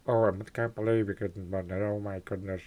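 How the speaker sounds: a hint of a flustered, rushed George Lucas. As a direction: gush it like a nervous George Lucas. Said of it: "I'd be saying it like George Lucas".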